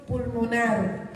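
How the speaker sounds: pulmonar said slowly, final r as l, pulmonal; slow lateral demo. Shown as a demonstration. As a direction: spell out pulmonar, ending in an l sound. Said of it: "Si es pulmonar el sufijo".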